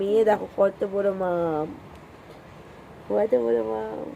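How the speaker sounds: showing her daughter the big fish, slow and soft. Real, not acted. Showing toddler a big fish piece.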